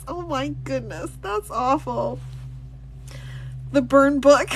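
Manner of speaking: ordinary delivery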